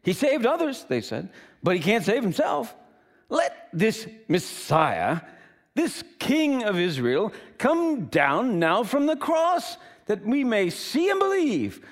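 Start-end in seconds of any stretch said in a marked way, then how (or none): 0.0-0.8 high-pitched taunt; mocking. Chief priests among themselves about Jesus, mocking. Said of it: "mocked him among themselves". 1.6-11.8 mocking. Chief priests sneering at Jesus on the cross, mocking. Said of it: "mocked him among themselves".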